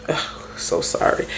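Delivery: breathless, whispered apology under the breath; whispered sorry. Real, not acted. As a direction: mutter a quick sorry without voice. Apologising for phone ringing mid-recording.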